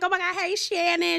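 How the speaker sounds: high-pitched gushing hello; excited greeting. Retold or imitated. Everyone greets Shannon as she arrives.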